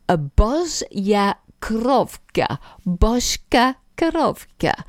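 slow, careful Russian-accented pronunciation; hint of Russian accent, drawn out; slight Russian accent. Shown as a demonstration. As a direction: say it slowly with a light Russian accent. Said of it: "say it with a slightly Russian accent".

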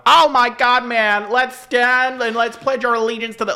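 high, excited American gush; American accent. Retold or imitated. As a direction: gush like an over-the-top American. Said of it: "Americans were like".

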